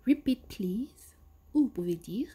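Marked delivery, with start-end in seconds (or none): 0.1-1.2 "Repeat, please" said slowly and clearly, rising at the end; slow teacher model. Shown as a demonstration. Teacher models the phrase for students, slowly. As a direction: spell it out slowly for learners. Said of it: "en disant".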